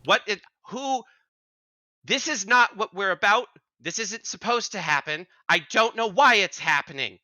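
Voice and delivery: high-pitched druid protesting; yelling druid. Acted. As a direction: argue in a high, exasperated character voice. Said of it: "are currently yelling at what appears to be a bush".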